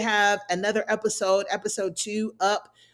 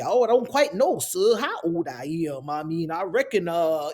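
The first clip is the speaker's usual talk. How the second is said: put-on old Southern man; 2 semitones lower than usual. Jack fooling the man hunting him, old Southern accent. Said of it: "old, deep Southern man from Mississippi".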